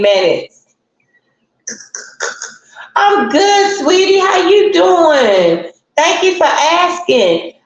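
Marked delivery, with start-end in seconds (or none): 3.0-7.5 sweet greeting. Real, not acted. Answering a viewer sweetly.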